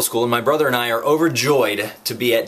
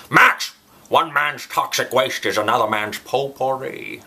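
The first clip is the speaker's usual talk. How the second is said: angry imitated Scottish accent with slushy S's; Sean Connery; slower than usual. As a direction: shout the name, then draw out the Scottish accent. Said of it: "angry Sean Connery accent".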